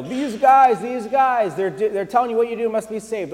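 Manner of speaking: high, loud imitation of a possessed girl's shouting; mock yelling girl. Pastor acting out the slave girl pestering Paul, high and loud. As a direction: yell it over and over like she's pestering them.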